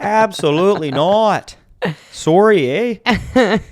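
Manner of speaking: high, loud hammed-up Canadian accent; over-the-top Canadian accent. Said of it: "the accent I cannot do".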